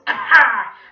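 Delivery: very loud, high cackle; scary villain laugh. A narrator's reading. Mister Gorf scaring the children, loud evil laugh. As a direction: cackle loudly like a creepy villain. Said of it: "even scarier than his real voice".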